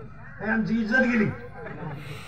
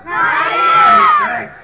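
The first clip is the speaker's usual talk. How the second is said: drawn-out high shout of correct; loud call; 8 dB louder, much slower than usual. Real, not acted. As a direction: stretch correct long and loud to rouse the crowd. Preacher shouts approval to crowd. (जोरात लांबवलेलं correct)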